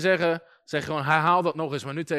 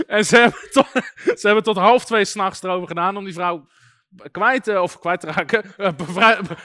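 real laughter breaking up the words; laughing while talking; 3 semitones higher, 7 dB louder than usual. Real, not acted. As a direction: try to carry on while cracking up. Breaks up laughing at own story.

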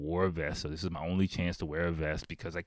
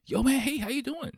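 high, bright-eyed friendly greeting. Retold or imitated. Stranger on the street greets the lost narrator, high and friendly. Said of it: "he's super friendly".

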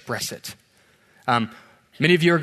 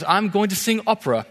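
higher-pitched, badly faked British voice; bad British accent; 6 semitones higher than usual. Nervous-free contestant answers the judge, bad British accent. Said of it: "That's my bad British accent".

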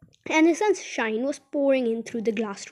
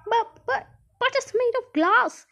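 very high-pitched stammered protest; alarmed shouting; 12 semitones higher, 4 dB louder than usual. A narrator's reading. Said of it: "shouted Grandpa Joe".

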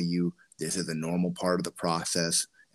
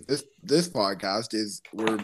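ordinary talk, nothing marked

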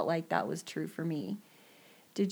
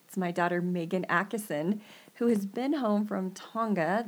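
ordinary talk

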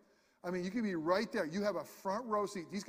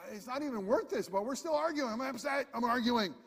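high, heated mock arguing voice; worked-up arguer; 5 semitones higher than usual. Preacher mimicking a spouse in a dumb argument, higher and heated. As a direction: imitate someone mid-argument, getting worked up.

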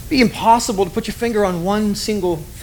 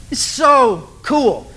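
excited exclaim; 3 semitones higher, much slower than usual. Real, not acted. Pastor telling the congregation the story is cool, thrilled shout. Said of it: "speaker's own enthusiasm".

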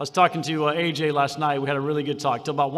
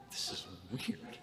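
very soft, low murmur; hushed aside; 9 semitones lower, 17 dB quieter, slower than usual. Retold or imitated. Audience murmuring to each other, hushed. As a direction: mutter it under your breath.

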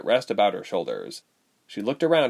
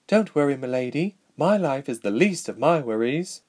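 lightly voiced loyal rider; 4 semitones higher than usual. Rider reassures the princess.

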